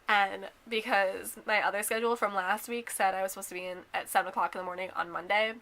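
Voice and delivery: ordinary delivery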